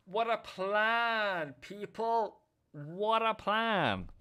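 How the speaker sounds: slow, high, savoured praise; proud. Real, not acted. Trader praising his plan to viewers, slow and gleeful. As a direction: stretch it out, relishing the win.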